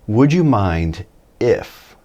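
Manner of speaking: slow, drawn-out would you mind if; slow gloss. Shown as a demonstration. Teacher slowly translating the phrase for learners. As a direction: spell out each word slowly. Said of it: "¿Te importaría si?".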